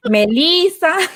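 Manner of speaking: slow, high Melissa with a Spanish lilt; Spanish-accented Melissa. Retold or imitated. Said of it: "Missy trying to tell her it's Melissa".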